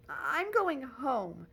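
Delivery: frightened wish to leave; quavering. Acted. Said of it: "quavered Missus Bowles".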